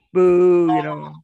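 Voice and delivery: a loud mock boo; demo boo. Shown as a demonstration. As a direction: boo like a crowd turning on him. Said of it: "you just".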